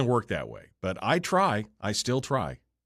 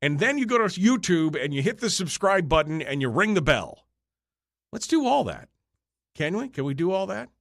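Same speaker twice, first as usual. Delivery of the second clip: excited; 9 semitones higher, 4 dB louder than usual. Real, not acted. Hyping viewers before air.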